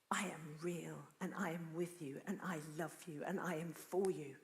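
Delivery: soft, hushed voice of God; tender reassurance. Acted. Preacher voicing God to a despairing friend, tender reassurance. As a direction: reassure gently, almost whispering at first.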